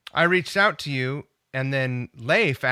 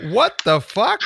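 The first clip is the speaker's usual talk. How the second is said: high, loud disbelief; incredulous outburst; 8 semitones higher, 8 dB louder than usual. Real, not acted. Driver reacting to empty city streets, incredulous. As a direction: burst out, totally baffled. Amazed at the free left turn.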